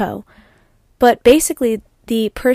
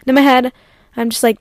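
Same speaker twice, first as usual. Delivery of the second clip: normal talking voice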